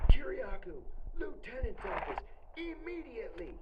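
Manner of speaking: high loud call over the PA; guard calling an inmate over the intercom; loudspeaker call. Retold or imitated. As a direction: bark it out like a prison announcement. Guard calling him over speaker.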